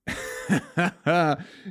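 high chuckling laugh. Real, not acted. Podcaster laughing off his own venting, high chuckle.

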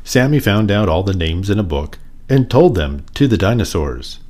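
usual speaking voice

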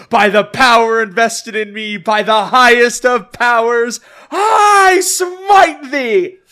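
loud, building to a shout; a knight proclaiming, rising to a yell; mock biblical prophet. Retold knight's final proclamation.

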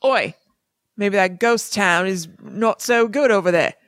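slow attempt at a British accent that slides toward Australian; an Oi-led, drawn-out mock British accent; British accent try. A Harry Potter character in a mock British accent. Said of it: "What would they say in Harry Potter?".

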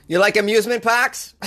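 usual speaking voice

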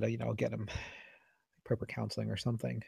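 hushed, almost breathless retelling; quiet aside. Retold or imitated. Telling the host what the neurologist probably said, very quietly. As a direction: drop to a near-murmur. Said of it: "she probably said".

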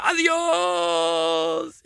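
high, stretched farewell yell; grito narrado. A narrator's reading. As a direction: yell the goodbye and hold the last vowel. (grito agudo y alargado)

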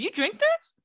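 high, incredulous grossed-out question; mock disgust. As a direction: imitate someone appalled by a drink. Acting out Elena disgusted by bourbon.

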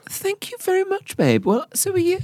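high sing-song thank you; high mock thanks. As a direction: gush it back in a high voice. Flirty mock-posh thanks.

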